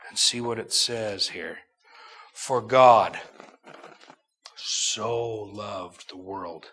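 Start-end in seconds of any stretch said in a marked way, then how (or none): 2.3-6.7 slow, deliberate reading, each word weighed. Shown as a demonstration. Preacher slowing the verse down for the congregation, slow. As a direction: draw out so loved the world. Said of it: "need to read it slow".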